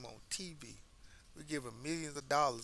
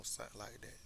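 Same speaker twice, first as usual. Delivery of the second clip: usual speaking voice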